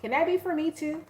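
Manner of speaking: high, bright-eyed mock viewer asking if it's for her; eager viewer voice. Tarot viewer hoping the reading fits her, in a high eager voice. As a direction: squeal it like a hopeful fan.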